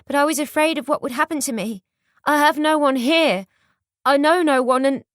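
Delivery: high, loud and hurried pleading; panicked pleading. A narrator's reading. Flora pleading, rising panic.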